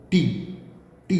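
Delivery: टी with a sharp, burst release of held air; plosive T demo. Shown as a demonstration. Teacher showing students the explosive टी sound. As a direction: pop the टी like a small explosion. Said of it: "जब आप बोलते हैं टी".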